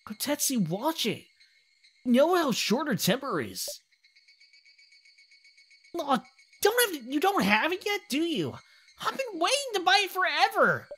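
put-on high-pitched whiny kid voice; excitable kid. Kids at the shop waiting to buy a model, impatient. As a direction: plead way up high like an impatient child.

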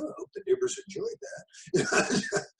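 a mock-horrified wry aside; wry joke. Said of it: "I was like".

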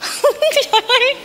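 high-pitched giggling laugh; giggle. Acted. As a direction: burst into a high giggle at a funny name. She laughs at his name.

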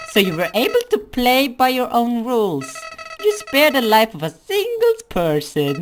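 high, loud sneering flower; Flowey voice. Acted. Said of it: "my flowery voice".

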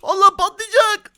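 high, loud drawn-out yell; hammed-up alarm shout; playful panic. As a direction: cry out before the blast. Bomb about to explode.

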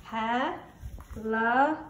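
Helicop- drawn out slowly, syllable by syllable; syllables split out slowly. Shown as a demonstration. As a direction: stretch Helicop- beat by beat as you tap. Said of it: "here I go".

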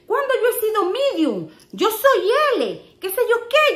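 husband fuming about the shirt size, retold high with a laugh; Jay offended. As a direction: imitate his wounded pride, half laughing. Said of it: "Jay se enojó".

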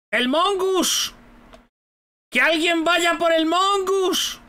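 high, slow drawn-out call. Real, not acted. Gamer urging teammates to chase the Mongoose, drawn-out shout. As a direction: stretch out the name like a rallying cry. Calling out an opponent mid-match.

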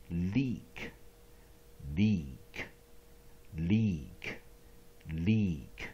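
leak pitched low, long E. Shown as a demonstration. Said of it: "Long vowel E". (低沉重复 leak，E 发长音)

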